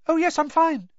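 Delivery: unnaturally high, jittery gabble; gabbled, unnaturally high. A narrator's reading. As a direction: squeak out that all is well. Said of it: "Neville gabbled".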